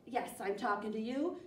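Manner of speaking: ordinary delivery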